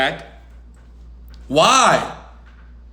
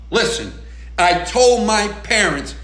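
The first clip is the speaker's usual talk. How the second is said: normal talking voice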